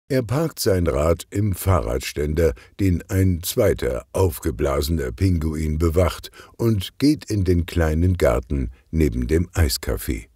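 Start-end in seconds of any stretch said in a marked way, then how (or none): none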